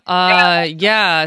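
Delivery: usual speaking voice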